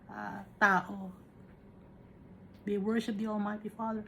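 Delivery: ordinary talk, nothing marked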